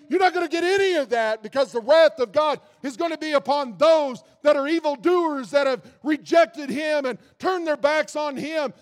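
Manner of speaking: loud, fast pronouncement of wrath; stern divine judgment. Retold or imitated. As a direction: thunder it out as an angry God. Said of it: "And God's gonna say".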